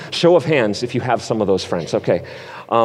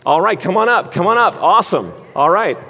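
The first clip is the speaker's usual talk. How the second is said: game host; 6 semitones higher than usual. Real, not acted. Hosting a Bible drill game.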